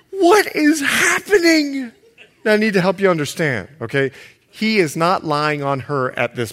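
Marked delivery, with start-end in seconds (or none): 0.1-1.9 much higher, slow, laughing disbelief; mock astonishment. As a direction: act scandalized and amused. Preacher reacts to racy verse.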